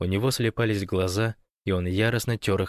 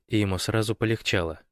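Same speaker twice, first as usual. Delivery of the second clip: ordinary delivery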